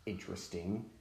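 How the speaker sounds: low, soft retold remark; quiet, low-key reaction, imitated; understated musing. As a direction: murmur it, barely concerned. Dad's understated reaction to heat.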